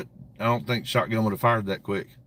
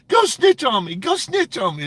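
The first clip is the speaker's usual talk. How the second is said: mock taunt; 11 semitones higher than usual. Streamer mimics rival taunting the prophet. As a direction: sneer it like a coward daring someone. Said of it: "He's like".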